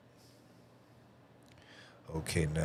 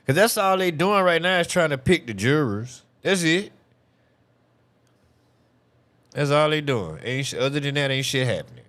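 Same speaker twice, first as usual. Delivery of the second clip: exasperated rant; 8 semitones higher, 6 dB louder than usual. Real, not acted. Ranting about the slow trial.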